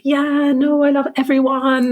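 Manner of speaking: high-pitched, breezy parody of loving everyone; mock all-loving. Mimics falsely positive attitude.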